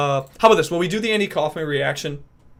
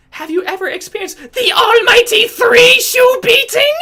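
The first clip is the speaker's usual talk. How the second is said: very high, loud and slow villain voice; Ralph impression; 9 dB louder, slower than usual. Streamer threatens a viewer as Ralph, high and slow. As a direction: menace them in a shrill cartoon voice. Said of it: "can I do Ralph from Ed, Edd n Eddy".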